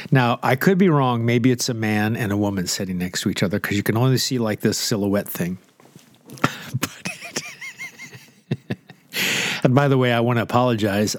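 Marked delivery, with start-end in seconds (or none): none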